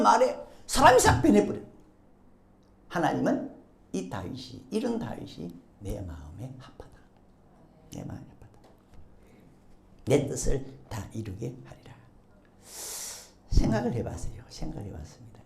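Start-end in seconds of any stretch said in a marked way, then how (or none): 2.9-11.9 low, hushed pronouncement; solemn divine approval. Acted. God declares approval of David, low solemn quoting.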